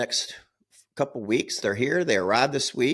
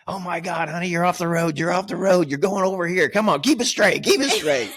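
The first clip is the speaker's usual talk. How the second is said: nervous backseat driver; 6 semitones higher than usual. Retold or imitated. Backseat driving at fiancée.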